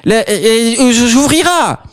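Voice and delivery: high, slow flustered granny; old lady voice. Acted. Wolf playing granny to the girl, high flustered voice. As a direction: stumble over the words in a high old voice. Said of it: "reprit alors sa voix de vieille fiotte".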